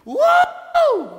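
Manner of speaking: very high, loud victory cry; loud triumphant wow. Shown as a demonstration. Preacher shouting wow in praise to the Lord. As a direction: let the wow burst out in triumph. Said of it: "To address a loud tone".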